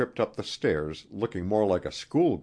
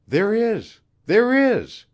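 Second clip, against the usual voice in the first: high, loud, mounting insistence; urgent eagerness; 9 dB louder, slower than usual. A narrator's reading. Queen seizing on Wilson's offer of help, urgent. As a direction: seize on it, rising higher and louder.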